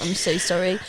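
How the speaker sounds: normal talking voice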